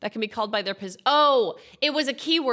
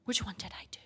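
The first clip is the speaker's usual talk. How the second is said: whispering; 7 dB quieter, faster than usual. Real, not acted. Whispered to self.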